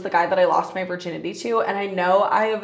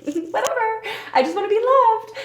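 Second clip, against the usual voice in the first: high-pitched parody of a delusional romantic; mock lovesick self; 14 semitones higher than usual. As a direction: whine it much higher, hammed up for laughs. Mocks her own delusional past self.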